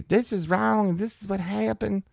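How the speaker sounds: very high, whiny mock outrage; mock whiny protest. Comic imitating her bullhorn protest, very high whine. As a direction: pitch it up into a whine. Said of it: "hers is just that whiny".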